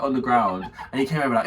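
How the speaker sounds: ordinary delivery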